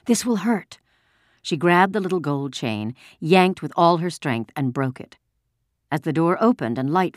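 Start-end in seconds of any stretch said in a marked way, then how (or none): 0.1-0.8 put-on high, urgent girl's warning; tense young girl in charge; commanding girl. A narrator's reading. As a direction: warn her quickly and firmly. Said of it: "Anne-Marie commanded".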